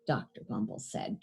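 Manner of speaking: ordinary delivery, nothing marked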